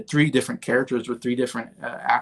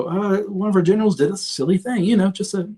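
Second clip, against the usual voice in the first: much higher, apologetic mock president voice; sheepish president; 8 semitones higher than usual. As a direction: squeak up to the last word like a guilty child. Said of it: "trying to break it to him".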